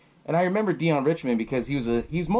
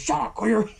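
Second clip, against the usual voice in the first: higher, lightly hammed-up scolding dad; tough-love dad; 7 semitones higher than usual. Dad answers his complaining son, mock scolding. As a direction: cut the son off in a put-on dad voice. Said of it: "he looks at him and he goes".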